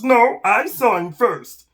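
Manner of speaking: high, loud, slow emphatic lizard; arguing lizard. Acted. Lizard fights the snake over prey, loud and insistent. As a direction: contradict loudly, punching each word. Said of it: "And the lizard says".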